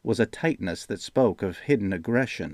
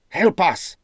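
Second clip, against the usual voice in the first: much higher, louder shout for help; 9 semitones higher, 5 dB louder than usual. A narrator's reading. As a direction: call for help across the yard. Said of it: "shouted Rigaldo".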